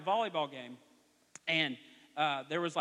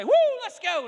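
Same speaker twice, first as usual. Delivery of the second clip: high, loud whoop at the game; excited cheering; 12 dB louder than usual. Retold or imitated. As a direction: cheer your kid's team on hard. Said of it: "I would say".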